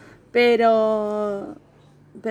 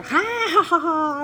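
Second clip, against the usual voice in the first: higher, raucous put-on laugh; mock loud laugh; 7 semitones higher, slower than usual. Shown as a demonstration. Showing how she won't laugh in a restaurant, mock guffaw. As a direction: cackle out loud on purpose. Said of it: "no voy a".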